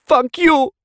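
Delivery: tearful woman curses lover, voice cracking. A narrator's reading. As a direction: break on the words in tears. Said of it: "her voice cracking".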